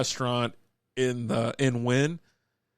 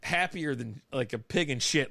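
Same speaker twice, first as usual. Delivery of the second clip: ordinary talk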